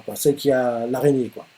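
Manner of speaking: ordinary delivery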